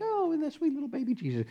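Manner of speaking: put-on high, fast, soft baby-talk coo; hammed-up sentimental gush; mock sweet cooing. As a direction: coo over the plastic baby mockingly. Mocking sentimental view.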